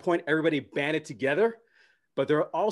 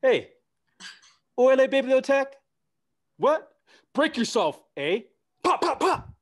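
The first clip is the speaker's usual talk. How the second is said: much higher and louder, hammed-up mock robbery; polite Canadian gangster; 9 semitones higher, 5 dB louder than usual. Canadian gunman on a drive-by, high and comically loud. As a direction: play a sweet Canadian mugging someone. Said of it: "It's like".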